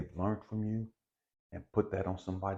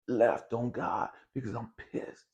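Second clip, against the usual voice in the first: ordinary delivery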